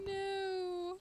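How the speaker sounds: high, drawn-out no; dismayed wail. Real, not acted. Player just died in the game, dismayed wail.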